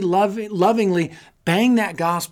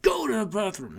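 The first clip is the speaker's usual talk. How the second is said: mock-exasperated, raised-pitch command; playacted fed-up plea; exasperated dog owner; 4 semitones higher, 3 dB quieter than usual. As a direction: order the dog out in comic frustration. Acting out exasperation with his dog.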